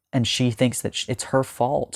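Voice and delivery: usual speaking voice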